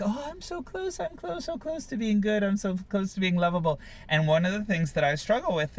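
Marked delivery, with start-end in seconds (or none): 0.0-3.8 high, breathy-sounding plea, very much higher at first; imitating her younger self reaching for approval; mock striving child. A woman mocks her past self begging to be good enough. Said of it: "running and reaching and like".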